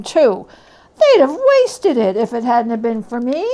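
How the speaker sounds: a callous thief laughing it off; loud mocking old woman; brazen charwoman. Charwoman boasts about the shirt.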